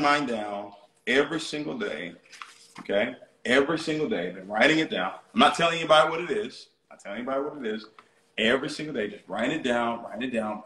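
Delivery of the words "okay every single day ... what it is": usual speaking voice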